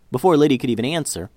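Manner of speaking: plain reading voice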